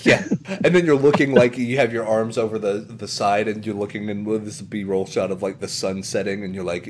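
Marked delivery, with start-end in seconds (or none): none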